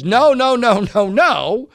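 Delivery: loud, high, repeated refusals; emphatic denial. Retold or imitated. Host retelling Robson's testimony, emphatic denial. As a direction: punch out each denial firmly.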